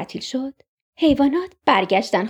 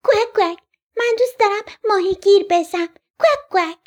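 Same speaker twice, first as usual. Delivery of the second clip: high-pitched quacking duckling; quack, then pipe up like a little duck; cute high duckling voice; baby duck; 7 semitones higher than usual. A narrator's reading. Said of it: "بچهٔ اردک گفت".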